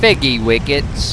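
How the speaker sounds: slow, lightly put-on British accent, "Figgy wickets" said with a rise; a touch of British toff; mock British accent. Mock British accent repeating the made-up food name. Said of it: "How is my British accent".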